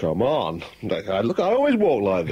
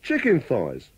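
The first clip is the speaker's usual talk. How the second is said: usual speaking voice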